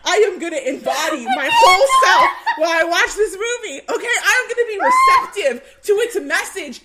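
loud, high rallying cry with laughter; mock rousing shout. To her friend Nat, retelling her vow to watch the film, laughing shout. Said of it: "I was like".

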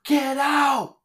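shrieked mock order; retold yell. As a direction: imitate a high-pitched panicked yell. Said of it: "she's yelling".

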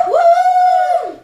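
excited cheer. Real, not acted. Cheering for national talent.

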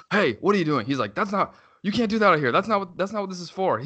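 high-pitched mock yell of an angry neighbour; imitated yelling. Neighbour telling off dad and child, imitated yelling. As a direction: scold like an outraged man at his window. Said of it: "starts yelling at me".

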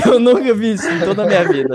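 talking through real laughter; laughing disbelief. Real, not acted. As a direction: laugh at the absurdity while protesting. Laughing at absurd accusation.

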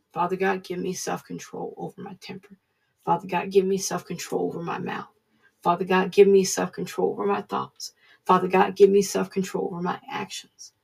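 soft, earnest repeated petition; quiet prayer. Retold or imitated. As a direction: pray it under your breath each morning. Retold daily prayer.

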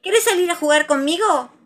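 high, loud playful invitation; eager Tiger. A narrator's reading. Tiger inviting Pooh to play, eager. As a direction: ask like an excited playmate.

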